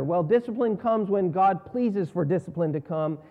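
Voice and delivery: ordinary talk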